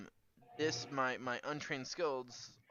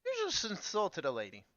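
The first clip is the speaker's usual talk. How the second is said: put-on lilting Irish accent, higher; 6 semitones higher than usual. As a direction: put on a lively Irish lilt. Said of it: "I'm all Irish now".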